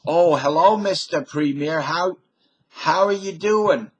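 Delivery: high, loud cheery greeting to the premier; a bright hammed-up hello; eager greeter. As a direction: play someone gushing hello to the premier. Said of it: "when people asked him".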